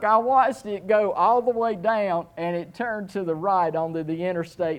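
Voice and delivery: ordinary delivery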